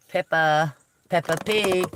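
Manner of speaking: put-on lightly done southern British accent, PIG landing with a falling tune; a playful southern British accent like the children's cartoon; mock British accent. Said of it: "my southern British accent today".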